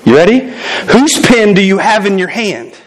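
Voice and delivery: usual speaking voice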